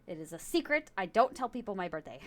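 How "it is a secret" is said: usual speaking voice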